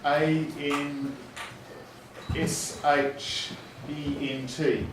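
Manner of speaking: letters spelled out slowly; slow school dictation. Shown as a demonstration. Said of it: "teacher demos spelling aloud".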